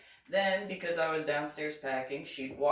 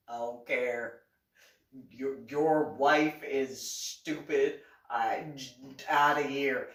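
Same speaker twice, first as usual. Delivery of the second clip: lower, slow scornful telling-off; mock dismissive; 6 semitones lower, slower than usual. Teller telling off Mike, slow and scornful. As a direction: sneer that his wife is stupid.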